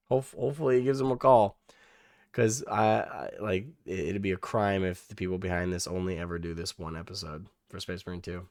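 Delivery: ordinary talk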